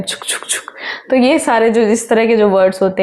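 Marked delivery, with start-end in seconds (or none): 0.0-0.7 breathy whispered "छुक छुक छुक छुक" like a train; whispered choo-choo rhythm; whispered train chugging. Shown as a demonstration. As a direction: puff it out like a toy train. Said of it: "और उसको कैसे कहते हैं हम?".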